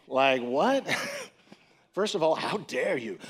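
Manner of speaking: laughing, indignant "what?"; incredulous, amused how-dare-you; mock outrage. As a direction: act offended, half joking. Shocked reaction to the accusation.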